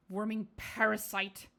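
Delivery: slow sneering insult in another voice; spiteful spirit voice. Acted. The spirit inside Alof sneering at him, slow and spiteful. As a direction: spit it at him like a hateful ghost.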